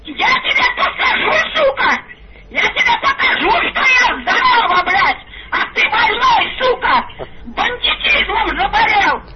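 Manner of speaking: furious shrieking, cursing through laughter; foul mouthed granny. Real, not acted. Furious old woman screaming on phone.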